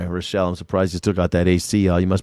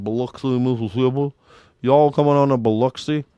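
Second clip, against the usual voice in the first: loose, slurred Mississippi imitation; slack-jawed Mississippi accent; 2 semitones higher than usual. Mocking Mississippi locals welcoming visitors, slack-jawed Mississippi accent. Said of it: "they got that slack-jawed accent".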